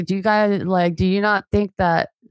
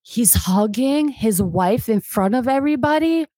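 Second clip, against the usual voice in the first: higher, incredulous question as if retelling the shock; shocked disbelief; 4 semitones higher than usual. Retold or imitated. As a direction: replay your old astonishment at a hug in public. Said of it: "I was shocked when I saw it".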